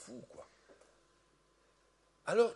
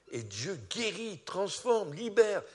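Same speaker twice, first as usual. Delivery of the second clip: each verb climbing higher and louder; rising fervour; 6 semitones higher, 5 dB louder, slower than usual. Real, not acted. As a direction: build from steady to a ringing peak. Preacher building to a climax.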